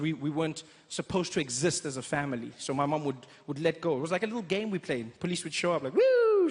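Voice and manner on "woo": high loud whoop of alarm; mock startled yelp. Mother startled by police, high loud yelp. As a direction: yelp as if caught out. Mom panics as police arrive.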